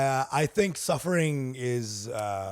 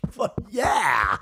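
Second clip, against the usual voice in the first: cheering crowd; 7 semitones higher, 4 dB louder than usual. Retold or imitated. Crowd cheering at heckler's death.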